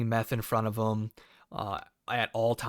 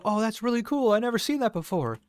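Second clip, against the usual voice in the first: much higher, gushing mock delight; fake enthusiasm; 10 semitones higher, 3 dB louder, faster than usual. To the woman showing her machete, a high gushing fake wow. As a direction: humor her with a chirpy put-on wow. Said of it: "So I go".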